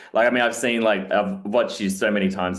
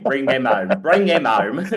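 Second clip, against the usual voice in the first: host mimicking the mock accent, louder; imitating the impression; 5 semitones higher, 5 dB louder than usual. Host repeating the guest's dodgy accent, mock imitation. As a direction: echo his dodgy Aussie line, playfully. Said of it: "it was a little bit Michael Caine".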